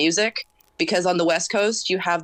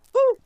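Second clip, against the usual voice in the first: imitated short "whew" exhale; little breath out; 4 dB louder than usual. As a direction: puff out a quick whew. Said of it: "let out that little".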